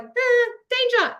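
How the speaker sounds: high, slow mock alarmed cry. Teacher voices the body's panic, high mock alarm. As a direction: squeal it like a startled body. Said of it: "your body is like".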